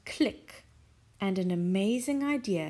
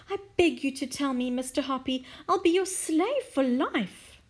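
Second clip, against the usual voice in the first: eager begging; 5 semitones higher, 4 dB louder than usual. Acted. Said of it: "cried Missus Silver".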